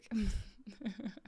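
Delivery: quiet laugh as it dawns; soft laugh. Real, not acted. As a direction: chuckle softly at the mix-up. Gets the drug joke, laughs.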